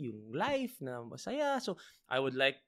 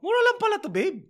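much higher, louder delighted aside; pleased surprise; 9 dB louder, faster than usual. Retold or imitated. Telling partner the briefs are cheap, high and delighted. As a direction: tell your partner it's a bargain.

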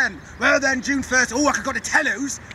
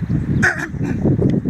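a loud, rough clear of the throat to warm up; 5 semitones higher, 4 dB louder than usual. Acted. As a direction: clear the throat before the pirate voice. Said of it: "work on my pirate accent".

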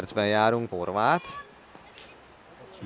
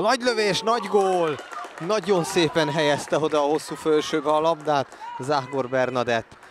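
excited goal call; 5 semitones higher than usual. Real, not acted. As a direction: burst out on a long-range goal. Third goal called. (izgatott gólkiáltás)

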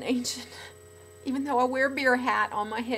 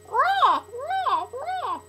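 short high squawked cries; parrot-like squawk; 13 semitones higher, 4 dB louder, much slower than usual. Acted. Imitating the owl, parrot-like squawks. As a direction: screech like a parrot. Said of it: "Sounds like a parrot".

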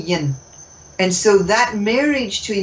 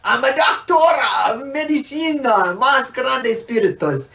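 mock Spanish accent; 4 semitones higher than usual. Mock Spanish self-title.

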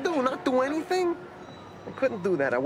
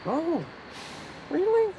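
mock surprise; 10 semitones higher than usual. Man retelling his fake reply to girlfriend, mock surprised. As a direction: pitch leaps higher on really, fake interest. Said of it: "I'd go".

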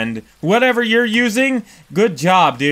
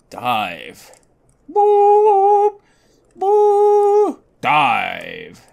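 playful dive noises; 10 semitones higher, much slower than usual. Pretend. Diving underwater, playful noises.

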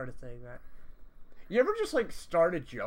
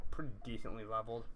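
normal talking voice

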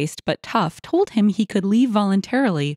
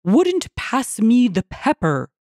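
slow, higher-pitched outraged complaint; mild narrated roar; 4 semitones higher, slower than usual. A narrator's reading. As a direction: stretch out the grievance, indignant. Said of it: "he roared".

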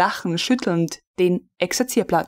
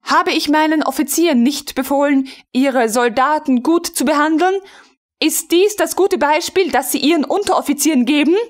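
raised, sharp scolding voice of a noble lady; angry rebuke; 7 semitones higher than usual. A narrator's reading. Frau von Melin scolding the officer for mistreating soldiers, angry. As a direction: scold the officer, higher and hotter. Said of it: "rief Frau von Melin zornig".

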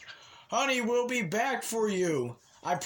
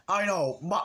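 ordinary delivery

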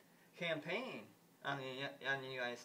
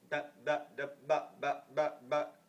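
stuttering mock robot voice, a bit louder; robot imitation; 3 dB louder, slower than usual. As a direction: stall like a malfunctioning robot. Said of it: "she talks like a robot".

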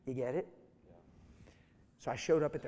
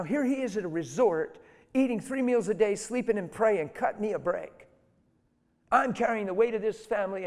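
retold angry rant, louder and higher; furious complaint, acted out; livid; 6 semitones higher, 9 dB louder than usual. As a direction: rail at them, fed up and indignant. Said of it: "And she's livid".

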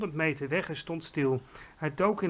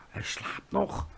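soft, voiceless stage whisper; 3 semitones higher, 5 dB quieter, slower than usual. A narrator's reading. GVR to Sophie beside the sleeping giant, hushed whisper. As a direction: whisper it under your breath. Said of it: "fluisterde de G V R".

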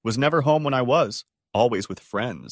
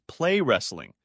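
plain reading voice

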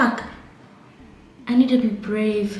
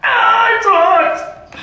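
higher, louder, slow pained moan; pained groan; 10 semitones higher, 8 dB louder, much slower than usual. Real, not acted. Hot wax on her skin, a slow pained moan. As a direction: drag it out, burning.

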